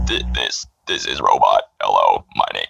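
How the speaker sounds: very low, droning robot. Shown as a demonstration. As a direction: demonstrate a deep mechanical robot voice. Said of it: "Can you make robot voice?".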